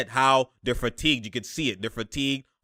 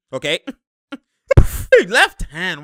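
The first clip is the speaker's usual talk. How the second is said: ordinary talk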